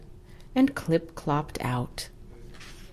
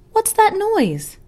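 much higher slow librarian voice; puzzled librarian; 6 dB louder than usual. Acted. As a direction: ask it like a startled librarian.

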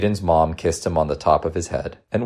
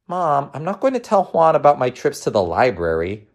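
put-on high-pitched kid protest; child objecting to mom; reluctant young boy; 10 semitones higher than usual. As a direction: refuse like an embarrassed schoolboy.

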